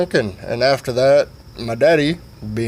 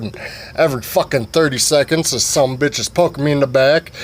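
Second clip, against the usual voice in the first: usual speaking voice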